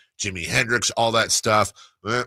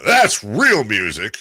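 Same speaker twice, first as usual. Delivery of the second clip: dad's music verdict; 3 semitones higher, 7 dB louder, slower than usual. Retold or imitated. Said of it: "Then he'd go to".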